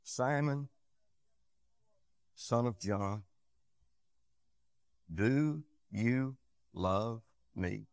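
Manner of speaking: soft, very slow voicing of Jesus; hushed, deliberate questioning with long pauses; quiet, solemn question. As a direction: ask it softly, spacing out each word. Voices Jesus questioning Peter gently.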